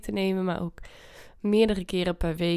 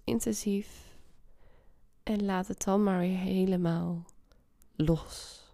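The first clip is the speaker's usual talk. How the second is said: guided meditation. Acted. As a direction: ease them into calm. Leads a meditation.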